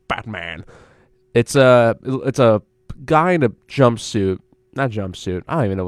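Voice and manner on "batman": "Batman" dropped low and soft, falling; low-voiced statement. Host answering own guess, low and soft falling "Batman".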